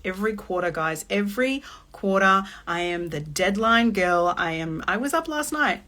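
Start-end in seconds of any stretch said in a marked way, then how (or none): none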